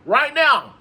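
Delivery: punchy urgent repeat; emphatic demand. Real, not acted. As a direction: slam down the demand.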